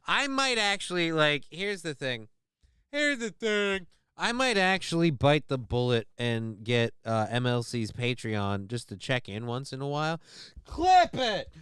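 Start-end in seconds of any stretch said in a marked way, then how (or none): 2.9-3.9 high put-on voice echoing the phrase; mock catchphrase voice. Host mocking his own stock phrase, put-on high voice. 10.6-11.5 high squawked mock call, louder; put-on catchphrase yell. Streamer calling for a clip, high put-on squawk.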